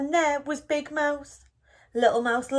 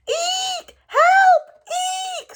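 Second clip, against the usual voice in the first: very high, loud panicked squeal; frightened squeak; 7 dB louder, much slower than usual. A narrator's reading. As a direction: squeal for help in terror. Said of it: "squeaked Little Mouse".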